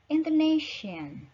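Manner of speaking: ordinary talk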